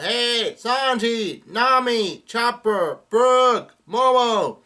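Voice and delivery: high, loud roll call of names; yelling out. A narrator's reading. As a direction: call each crewmate out loudly. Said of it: "And Luffy's yelling out".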